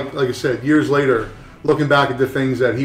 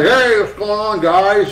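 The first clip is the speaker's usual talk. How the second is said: higher, casual greeting in a put-on voice; breezy hello like an old-timer dropping by; imitating Mike Malone; 7 semitones higher, slower than usual. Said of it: "he's like".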